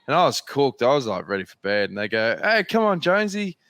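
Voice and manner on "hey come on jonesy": mates egging on. Retold or imitated. Teammates goading a tired rider to the nightclub, mock high call. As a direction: imitate mates goading him out. Mates urging him to party.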